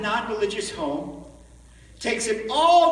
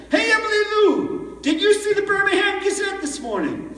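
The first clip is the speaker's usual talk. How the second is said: put-on excited girlfriend; 11 semitones higher, 4 dB louder than usual. Girlfriend calls with news.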